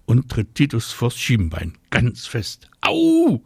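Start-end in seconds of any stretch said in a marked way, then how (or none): 2.8-3.4 very high, loud yelp; loud high cry of pain; pained bellow. A narrator's reading. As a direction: howl out as if kicked in the shin. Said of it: "brüllt er".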